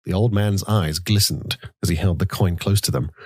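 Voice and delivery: plain reading voice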